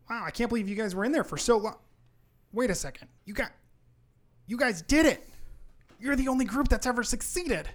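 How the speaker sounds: high, loud, astonished elf; amazed elf. Acted. Elf realizing they are the first to succeed, amazed. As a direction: marvel at them, rising to loud excitement.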